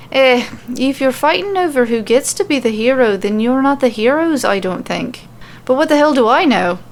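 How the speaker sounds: wry Irish lilt, shrugging it off; offhand Irish brogue, lightly voiced; Irish accent. Becky doubting who the heroes are, wry Irish accent. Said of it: "super hard to switch between accents".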